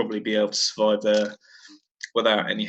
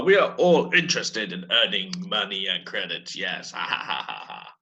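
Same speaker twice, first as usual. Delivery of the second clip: Hutt laughing; 4 semitones lower than usual. Acted. Hutt answers then laughs.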